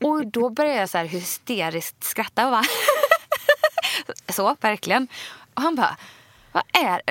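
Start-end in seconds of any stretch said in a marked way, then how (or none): 2.7-4.2 hysterical high laugh. Real, not acted. Nervous bride-to-be bursting into hysterical laughter at her boyfriend.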